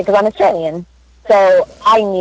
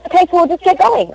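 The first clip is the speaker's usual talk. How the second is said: higher-pitched mock director getting things going; retold director's brisk line; 11 semitones higher than usual. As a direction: imitate a breezy director, pitched up.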